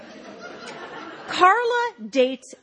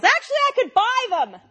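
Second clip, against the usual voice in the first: mock yell; 11 semitones higher, 3 dB louder than usual. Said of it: "thinks about it and yells".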